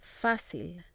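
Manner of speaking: "Fácil" with the weight on FÁ; stress on accented syllable. Shown as a demonstration. Teacher models written accents, stress on the marked syllable. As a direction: punch the first syllable of "Fácil". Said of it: "to stress the correct syllable".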